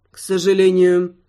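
"К сожалению" said low and mournfully drawn; low mock-sorrowful lord. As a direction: sigh "К сожалению" in a low, put-on grief. Character take, low and put-on.

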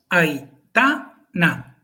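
Aitana drawn out slowly, three clear syllables. Shown as a demonstration. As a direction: spell out Aitana slowly, stress on ta. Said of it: "Son tres sílabas". (Aitana despacio, sílaba a sílaba)

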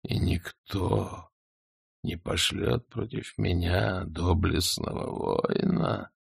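sorry for himself, slowly; plaintive dragon. A narrator's reading. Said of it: "подумал дракон почти жалобно".

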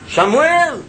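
high, loud call of a name; calling voice of God. Retold or imitated. God's voice calling the sleeping boy, high and loud. Voice calls Samuel a third time.

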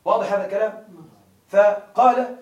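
ordinary delivery

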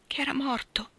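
whispered, breaking into tears; a grieving girl whispering the worst; near tears. Acted. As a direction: let it fall away into a whisper. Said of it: "Non piangere".

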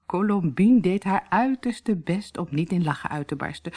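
ordinary delivery

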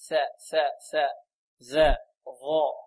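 ثاء، ذا، ظا each isolated with the tongue between the teeth; tongue-out interdental letters. Shown as a demonstration. Teacher demonstrates tongue placement, interdental ثاء، ذا، ظا. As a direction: sound out TH, DH and heavy DH in ثاء، ذا، ظا. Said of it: "لابد من إبراز اللسان".

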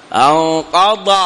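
slow أنقض with the tongue kept in for the ض; ض without tongue. Shown as a demonstration. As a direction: stretch out the ض of أنقض. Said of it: "لا تخرج فيه اللسان".

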